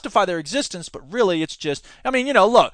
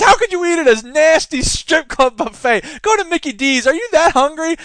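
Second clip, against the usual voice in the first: Chris Rock bit; 9 semitones higher, 4 dB louder than usual. Retold or imitated. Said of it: "And he goes".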